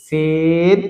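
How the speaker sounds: সিন with a long, held vowel; drawn-out letter name. Shown as a demonstration. Teacher naming a letter for learners, drawn out. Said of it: "টেনে বলতে হবে".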